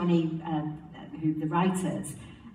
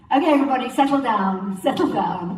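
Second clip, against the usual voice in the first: louder call of Okay, everybody, settle down; calling the cast to order; 5 semitones higher, 8 dB louder, faster than usual. Retold or imitated. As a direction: hush the giggling room with a raised call. Calming the laughing cast.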